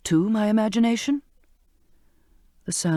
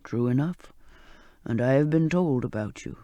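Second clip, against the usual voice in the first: put-on old sailor's voice; 6 semitones lower than usual. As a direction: coax her gently to touch him. Old man forgives.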